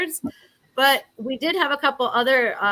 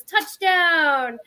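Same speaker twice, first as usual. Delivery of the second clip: touchdown yell; 7 semitones higher, 3 dB louder than usual. Shown as a demonstration. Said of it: "everybody goes".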